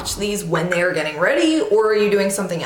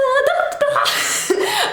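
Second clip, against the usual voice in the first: bright high burst of giggling; high giggle. Real, not acted. Laughing at her own sung tagline, high giggle.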